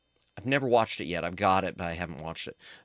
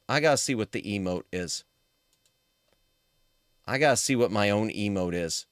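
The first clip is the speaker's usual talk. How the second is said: ordinary talk, nothing marked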